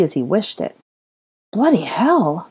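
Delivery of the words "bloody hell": dumbly repeated, slightly higher exclamation; dumbstruck. Acted. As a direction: exclaim it in stunned disbelief. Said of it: "the red-haired man repeated dumbly".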